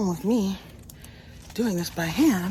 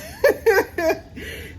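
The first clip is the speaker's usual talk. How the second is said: squealing high burst of laughter. Real, not acted. Explaining the bucket water, a high burst of laughter.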